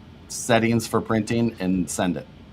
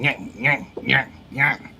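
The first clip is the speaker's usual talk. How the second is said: higher, repeated "nyah" noises; short imitated cranking noises; imitated repetitive noise; 4 semitones higher than usual. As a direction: mimic a straining pull with short nyahs. Imitates noise while describing trying.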